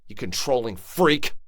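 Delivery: high, loud defiant snap at the rival; angry retort. Acted. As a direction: throw the insult right in his face. Insults jealous boyfriend.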